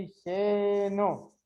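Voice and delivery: slow, evenly stretched syllables. Shown as a demonstration. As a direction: draw out each syllable for the class. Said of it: "separa em sílabas". (sílaba por sílaba, devagar)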